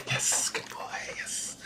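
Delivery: soft whisper; whispered praise. Real, not acted. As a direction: breathe it to him.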